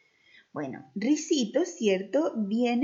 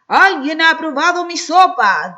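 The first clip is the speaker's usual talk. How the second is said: papa bear's gruff voice; 6 semitones higher, 14 dB louder than usual. Acted. As papa bear finding his soup eaten, loud gruff voice. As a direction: boom it out like a huge grumpy bear. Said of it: "dijo papá oso con voz ronca".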